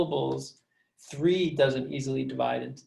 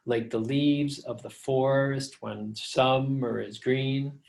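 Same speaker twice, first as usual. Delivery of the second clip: slow galloping chant, stressing LEAVES, FOR-, SUM-, GREEN; exaggerated anapestic beat; slower than usual. Shown as a demonstration. Teacher showing how you wouldn't read it, exaggerated metrical beat. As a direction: pound out "like the leaves" as two light beats and a heavy one. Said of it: "you wouldn't read it like that".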